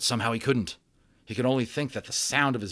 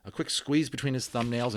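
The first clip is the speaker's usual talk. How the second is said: ordinary delivery, nothing marked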